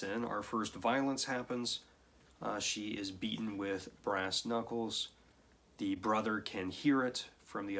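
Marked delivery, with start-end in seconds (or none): none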